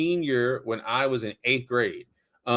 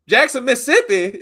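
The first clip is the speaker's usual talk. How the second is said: mock Mississippi accent, pitched up; mocking echo; 10 semitones higher, 8 dB louder than usual. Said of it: "just like I said it".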